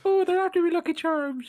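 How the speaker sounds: very high, loud cartoon Irish; over-the-top Irish mascot shriek; fake Irish accent. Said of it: "do you have one fake Irish accent".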